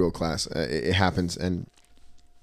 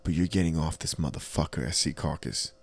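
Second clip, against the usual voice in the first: quiet menace; 3 semitones lower, 6 dB quieter than usual. Retold or imitated. Conductor threatening fare dodger, quiet menace. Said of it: "I was like".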